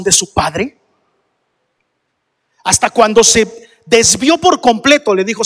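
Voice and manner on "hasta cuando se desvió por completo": ordinary talk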